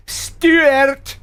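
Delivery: "Stuart" pitched high and stretched out; exaggerated Scottish pronunciation. Shown as a demonstration. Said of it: "Did I get it?".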